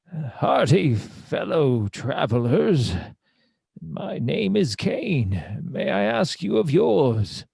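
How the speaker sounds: slow, grand greeting with big pitch swoops; theatrical adventurer. Acted. Kane introduces himself to two hunters, slow and grandly theatrical. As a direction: draw out each phrase like a pompous herald.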